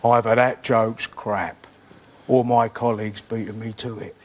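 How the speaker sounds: low, slow gruff East End comic; a low, slow deadpan comic put-down; Mike Reid voice. Retold or imitated. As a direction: drag it out, deadpan and unimpressed. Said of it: "He said".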